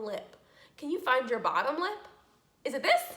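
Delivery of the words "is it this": higher, louder teasing guess; playful mock guess. Teacher teasing the class, playful mock guess. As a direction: ask it like a silly wrong guess.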